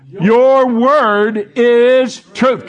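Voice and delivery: very slow, loud, drawn-out proclamation; boomed scripture. Shown as a demonstration. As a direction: boom the verse out. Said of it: "boom it out".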